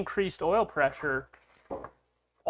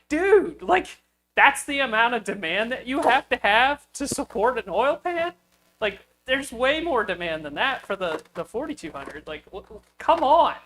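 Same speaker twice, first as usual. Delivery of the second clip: much higher and louder, an exasperated outburst; lost his cool; 6 semitones higher, 5 dB louder than usual. Retold or imitated. Ranting at the booth guy about oil pan demand, heated. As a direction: let the frustration burst out in disbelief. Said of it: "I kinda lost my cool a little bit".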